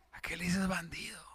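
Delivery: voiceless whisper; whispered aside. Real, not acted. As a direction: lean in and whisper the question. Aside questioning the greeting. (susurro confidencial)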